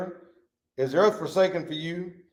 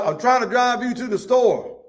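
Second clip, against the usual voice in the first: a hint of the driver, pitched up; patient driver; 8 semitones higher, 4 dB louder than usual. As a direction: plead gently with the passenger. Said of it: "First time he drives".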